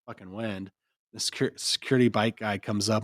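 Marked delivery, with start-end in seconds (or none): none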